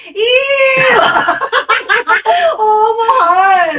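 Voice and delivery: high, loud laughing "ew"; kids' disgusted squeal. Retold or imitated. Kids reacting to the smelly toilet cubicle, high laughing squeal. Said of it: "Sabi ng mga anak ko".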